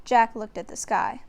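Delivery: usual speaking voice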